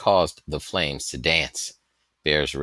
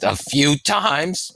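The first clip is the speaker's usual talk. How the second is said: amazed echo; 10 semitones higher, 5 dB louder, much slower than usual. Acted.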